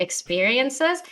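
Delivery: usual speaking voice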